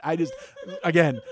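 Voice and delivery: ordinary delivery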